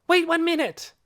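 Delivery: very high, loud plea to wait; crying out. A narrator's reading. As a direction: stop someone with an urgent cry. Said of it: "she cried".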